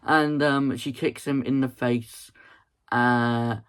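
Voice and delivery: ordinary talk, nothing marked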